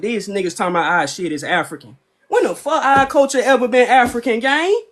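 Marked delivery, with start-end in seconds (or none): 2.3-4.8 outraged disbelief. Real, not acted. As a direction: throw it out in disbelief, pitched up. Outraged rising protest.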